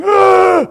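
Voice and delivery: put-on a boy's high, loud, repeated startled screams; piercing high cries of fright, replayed in full; imitated screams. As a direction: scream out each Ah high and loud, like a scared child. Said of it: "the boy's just going".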